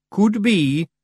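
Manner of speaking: sheepish tiny Scots pixie; Feegle voice. A narrator's reading. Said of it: "said Daft Woolly".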